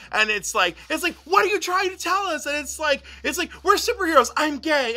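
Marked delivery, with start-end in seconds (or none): 1.2-2.5 mock worried parent. Parents asking the kids. 3.6-5.0 excited confession. Retold or imitated. Kid's excited confession retold.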